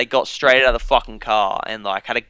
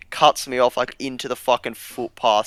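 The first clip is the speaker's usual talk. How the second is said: ordinary delivery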